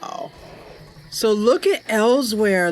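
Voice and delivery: ordinary talk, nothing marked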